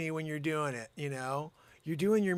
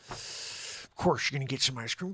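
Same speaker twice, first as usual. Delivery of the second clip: ordinary talk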